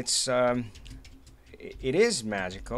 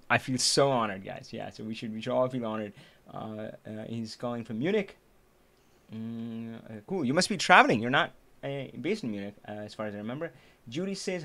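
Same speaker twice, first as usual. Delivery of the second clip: normal talking voice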